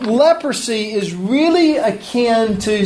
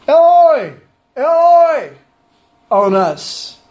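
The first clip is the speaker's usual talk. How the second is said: high, loud cry of Eloi, Eloi; 5 semitones higher, 3 dB louder, slower than usual. Acted. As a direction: call out for mercy from afar. Lepers crying out for mercy.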